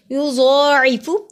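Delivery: युज़ाइफु slow, heavy ज़ and long ज़ा; slow tajweed demo. Shown as a demonstration. Teacher demonstrating to students. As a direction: draw out the ज़ा heavily. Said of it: "ज़ौत मोटा हरूफ है".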